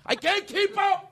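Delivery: high, strained mock panic, slowing into a wail; mock-panicked yell, drawn out at the end; panicked rivals. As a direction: act out rival podcasters freaking out, voice climbing high. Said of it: "They're like".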